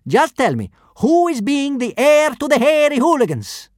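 high, loud brute in broken Norse; kidnapper voice. A narrator's reading. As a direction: boom it out like a thug. First kidnapper again.

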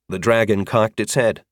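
usual speaking voice

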